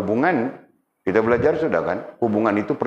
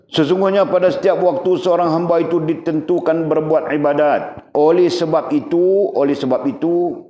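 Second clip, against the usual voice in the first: higher, louder formal text reading; 9 semitones higher, 5 dB louder than usual. Acted. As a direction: read the passage out like a kitab. (membaca teks kitab dengan nada tinggi dan lantang)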